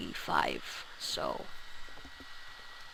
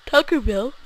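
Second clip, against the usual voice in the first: words stretched by a yawn; 4 semitones higher, 6 dB louder than usual. Real, not acted. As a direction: say it mid-yawn. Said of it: "Sorry if I'm yawning".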